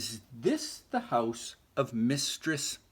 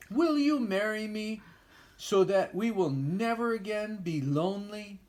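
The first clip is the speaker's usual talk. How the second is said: higher, hopeful little proposal; hopeful mouse voice; 7 semitones higher than usual. Acted. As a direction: ask sweetly and hopefully.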